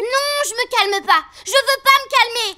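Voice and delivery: put-on high, furious child's outburst; angry yelling. Child refusing to calm down for her mother, yelling angrily. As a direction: yell back in a full tantrum. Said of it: "je te laisse hurler toute seule".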